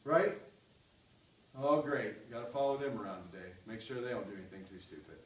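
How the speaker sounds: mock groggy grumble, soft and fast; sleepy grumbling Jesus. Preacher mocking a sleepy, put-upon Jesus, soft fast grumble. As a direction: grumble like you just rolled out of bed. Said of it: "he's kind of waking up like".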